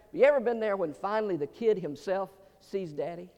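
ordinary talk, nothing marked

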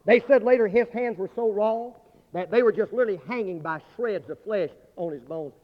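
ordinary delivery

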